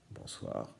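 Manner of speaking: "Bonsoir" whispered, no voice at all. Shown as a demonstration. Teacher to pupils whispering the word back. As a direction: breathe the word softly as a secret.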